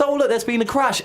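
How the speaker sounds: high-pitched mock spectator cry; mock excitement. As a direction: act out a spectator spotting a crash. Mock race spectator reacting to crash.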